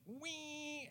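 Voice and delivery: high, drawn-out motor noise; tiny engine whine. Retold or imitated. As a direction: imitate the little boat puttering away. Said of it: "he's disappearing, going back towards the island".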